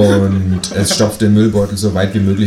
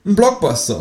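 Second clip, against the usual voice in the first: clipped kangaroo demand; 11 semitones higher than usual. Acted. (hohe Känguru-Stimme)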